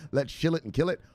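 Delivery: high, pumped sign-off with a fast plug; hyped outro. Acted. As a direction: sell the channel like a showman closing the show.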